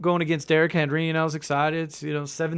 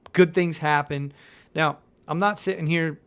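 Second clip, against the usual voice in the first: ordinary talk, nothing marked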